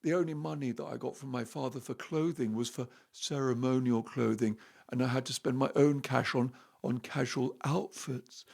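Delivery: soft, muted mock-prince voice; imitated prince. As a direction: play him quietly sorry for himself. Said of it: "He said".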